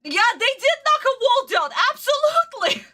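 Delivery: very high, worked-up voice; overwrought, pitched far up; high squeal. Real, not acted. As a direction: squeak it out emphatically. Worked up, answering chat.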